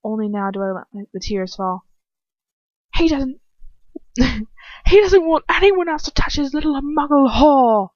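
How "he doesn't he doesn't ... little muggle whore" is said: raised, slow, cracking cry through laughter; anguished outburst. Acted. Reading the story, she bursts out in anguish. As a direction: spit the words out in despair, loud and high.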